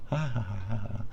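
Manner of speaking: soft put-on mad laughter; lunatic laugh. Self-declared lunatic laughing as everything burns, softly unhinged. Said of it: "I'm a lunatic".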